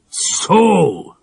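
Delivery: a menacing man; drawn-out threatening "so"; villain's growl. A narrator's reading. Villain confronting the girls, menacing. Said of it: "said the man".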